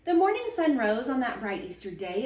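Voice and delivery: plain reading voice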